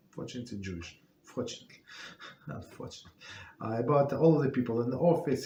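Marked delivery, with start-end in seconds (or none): 0.1-1.0 dropped low and soft under the breath; half swallow it as a quick aside; a soft murmured afterthought; muttered aside. Real, not acted. Muttered aside, then corrected. 1.2-3.2 breathy whisper with a small laugh; sheepish whispered fix; whispered correction. Real, not acted.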